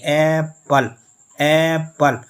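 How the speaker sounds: slow Apple with a drawn-out, strong first syllable; long stressed first syllable. Shown as a demonstration. Teacher showing a longer stressed syllable, first syllable of Apple held long. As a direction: stretch the ए of Apple, then let the ending fall. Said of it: "louder पढ़ रहे हैं और longer पढ़ रहे हैं".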